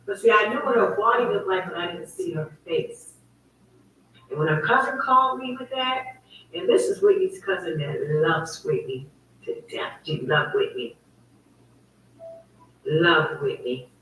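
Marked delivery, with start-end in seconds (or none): none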